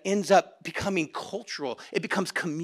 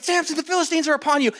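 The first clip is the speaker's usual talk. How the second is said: mock alarm; 8 semitones higher, 3 dB louder, faster than usual. Delilah's alarm call.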